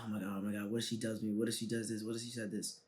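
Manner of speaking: quiet, worried inner monologue; spiralling what-ifs under the breath; anxious inner voice. Retold or imitated. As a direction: mutter the nerves to yourself. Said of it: "I'm just in my head".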